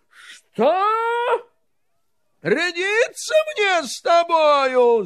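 высокий, громкий, чужой голос в ярости; царь в гневе кричит; гневный крик царя. Acted. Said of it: "закричал он". (царь яростно кричит на Ивана)

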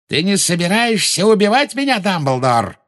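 high-pitched mocking exclamation; high taunt falling on the name; exclaiming villain. A narrator's reading. Villain taunting his enemy over the shield, exclaiming. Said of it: "воскликнул Волан-де-Морт".

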